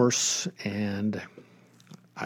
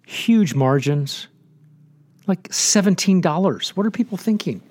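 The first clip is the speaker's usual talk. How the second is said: incredulous; 7 semitones higher, 4 dB louder than usual. Real, not acted. Mocking overpriced books.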